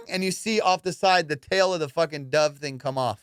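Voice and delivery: normal talking voice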